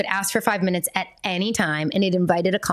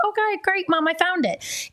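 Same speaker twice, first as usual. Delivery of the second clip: put-on child's voice; 10 semitones higher than usual.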